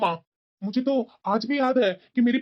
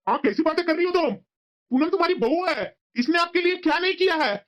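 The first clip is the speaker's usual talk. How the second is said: higher-pitched, outraged remonstrating; indignant protest; 5 semitones higher than usual. Acted. Son defending his wife to his mother, indignant.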